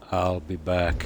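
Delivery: lightly voiced action-hero catchphrase; a hint of the movie cyborg; Arnold Schwarzenegger impression. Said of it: "Another one was".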